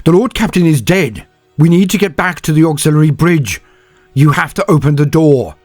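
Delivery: high, loud, pressing news; urgent shout. A narrator's reading. Senyavina tells Vitko the captain is dead, urgent and loud.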